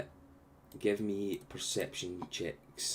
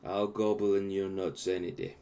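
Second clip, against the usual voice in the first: normal talking voice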